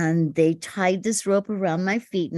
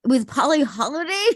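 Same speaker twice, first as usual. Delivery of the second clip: incredulous; 6 semitones higher, 3 dB louder than usual. Retold or imitated. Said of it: "I said".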